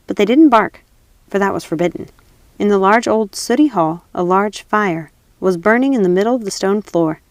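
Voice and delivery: ordinary delivery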